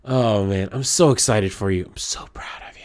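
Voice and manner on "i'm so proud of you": soft whispered pride; tender whisper. Real, not acted. Telling a friend how proud, in a whisper. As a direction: whisper it close and sincere.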